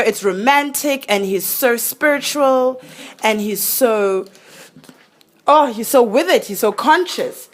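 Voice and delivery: playful imitation of being swept away; mock smitten gushing. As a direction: gush like a smitten fan, teasing. Mimicking a smitten young woman.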